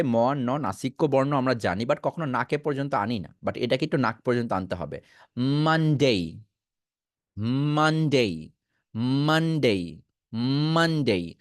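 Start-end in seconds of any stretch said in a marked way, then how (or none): 5.4-11.3 মানডে with the ম and ন pushed into the nose, slow; nasal Monday demo. Shown as a demonstration. Teacher demonstrating to students, slow nasal মানডে. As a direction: stretch মানডে out through the nose. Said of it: "এই ম আর নটাকে নাকে আনতে হবে".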